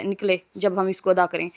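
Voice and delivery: ordinary talk, nothing marked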